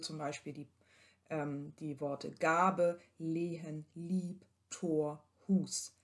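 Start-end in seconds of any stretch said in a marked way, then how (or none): 2.3-5.9 Gabe, Lehen, Tor, Hus with long drawn vowels; long vowel examples. Shown as a demonstration. Lecturer models circumflex long vowels for students. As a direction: hold each vowel long. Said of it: "werden auch lang gesprochen".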